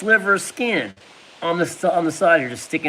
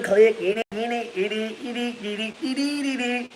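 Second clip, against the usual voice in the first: clicking noise; 6 semitones higher, 5 dB quieter than usual. Retold or imitated. Imitating jammed gun clicking.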